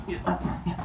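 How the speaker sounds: soft, voiceless grunts of effort; straining to lift, under the breath; acted straining. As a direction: mime heaving a heavy sack. Acts heaving the bag of gold.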